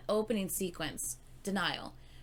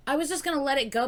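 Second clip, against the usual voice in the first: fast and loud, all worked up; excited; 6 semitones higher, 6 dB louder, much faster than usual. Real, not acted. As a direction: rush it out, buzzing with excitement. Excited rush of reaction.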